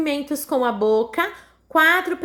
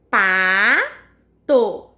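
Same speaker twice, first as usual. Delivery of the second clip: "pato" split wide as paa... too, lower; separando sílabas; 4 semitones lower, 4 dB louder than usual. Shown as a demonstration. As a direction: stretch "pato" into two long separate syllables. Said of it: "Como que eu separo a palavra pato?". (pato bem separado, pa... to)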